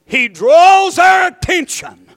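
slow, high, loud proclamation; preaching shout. Real, not acted. Preacher proclaiming to the church in a slow shout. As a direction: call it out slowly from the pulpit.

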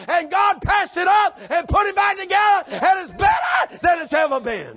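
impassioned preacher, rising into full cry; building revival preaching. Acted. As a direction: build the testimony to a hollered climax.

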